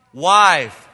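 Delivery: shouted with the crowd. Shown as a demonstration. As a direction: boom out "Wife". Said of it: "Let's say it again".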